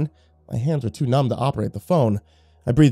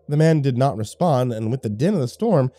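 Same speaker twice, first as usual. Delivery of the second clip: ordinary delivery, nothing marked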